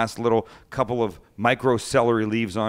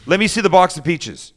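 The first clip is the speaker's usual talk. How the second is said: higher and louder, furious demand; angry chef; 8 semitones higher, 9 dB louder than usual. Retold or imitated. Chef yelling at the cook to show him the peach box, furious. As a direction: bark it at the cook across the kitchen. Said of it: "He goes".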